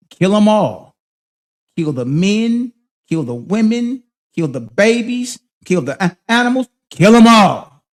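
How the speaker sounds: preacher reciting a damning verse; emphatic scripture quote. Acted.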